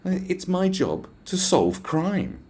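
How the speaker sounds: high, very slow, drawn-out declaration; self-important officer. Retold or imitated. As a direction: imitate a pompous officer stating a mission. Mimics the officer's self-important answer.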